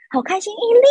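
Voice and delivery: high, sugary greeting imitating the mother; gushing mother. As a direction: coo her name like a delighted auntie. Mother greets new girlfriend delightedly. (模仿阿姨高音甜腻地打招呼)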